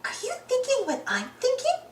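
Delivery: high, soft, voiced hush; hushed high cartoon voice; stage whisper. A narrator's reading. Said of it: "whispers Daisy".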